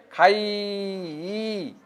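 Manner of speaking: খাই stretched, then the final ই isolated; drawn-out ending. Shown as a demonstration. Teacher showing what sound ends the verb, ই drawn out. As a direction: draw out খাই and echo its ই by itself. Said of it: "ই হচ্ছে".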